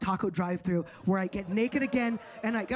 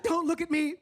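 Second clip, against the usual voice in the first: high whiny; 7 semitones higher, slower than usual. Retold or imitated. Ashamed, makeup gone.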